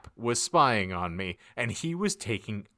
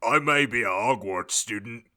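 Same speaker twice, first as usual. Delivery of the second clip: angrily; 4 dB louder than usual. Acted. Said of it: "Hagrid paused angrily".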